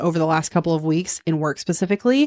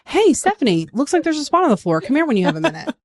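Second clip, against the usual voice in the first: high, easy singsong call; pitched up, friendly and unhurried; light and reassuring; 7 semitones higher than usual. Shown as a demonstration. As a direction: model a no-alarm request. Models an unalarming way to ask.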